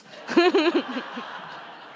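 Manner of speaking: high, bubbling laugh; real laugh. Real, not acted. Reader laughing after Ben's line, a high bubbling laugh. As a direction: laugh at your own story's punchline.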